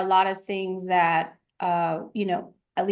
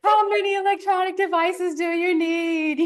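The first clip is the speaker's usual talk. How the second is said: put-on higher, whiny grown-up child complaining; a son in therapy mocking his doting parents, higher voice; mock grown kid in therapy; 8 semitones higher, 4 dB louder than usual. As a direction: play an adult kid griping to a therapist, pitched up. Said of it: "And he's like".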